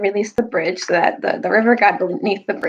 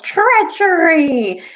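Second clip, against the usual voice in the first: a long, drawn-out high yell of Treachery; 9 semitones higher, 4 dB louder, much slower than usual. Retold or imitated. Lords yelling Treachery across the battlefield, drawn out. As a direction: stretch Treachery out slowly into a high call. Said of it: "they yelled".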